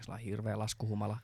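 ordinary talk, nothing marked

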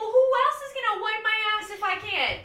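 high, loud mocking retort; sassy kid. Kid sassing the parent, high and mocking. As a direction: talk back cheekily.

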